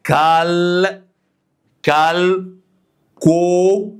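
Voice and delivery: "كال" and "كو" called out high and loud; syllable drill. Shown as a demonstration. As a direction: bark each syllable for students to repeat. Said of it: "يلا اهو نقولها كده".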